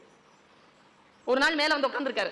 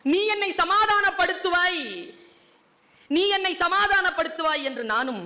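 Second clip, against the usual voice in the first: heartfelt recitation; 5 semitones higher than usual. Acted. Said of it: "எங்க அப்பாவை உலுக்குன பாட்டுங்க".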